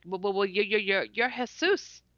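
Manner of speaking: imitated stammer of amazement; stuttering. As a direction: trip repeatedly over the first words, then get it out. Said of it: "and I'm stuttering".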